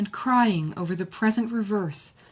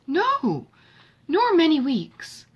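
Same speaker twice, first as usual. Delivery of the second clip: high and emphatic denial; surprised protest; 9 semitones higher, 5 dB louder, slower than usual. A narrator's reading. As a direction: Marianne insists he'll be back soon. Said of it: "cried Marianne with a strong surprise".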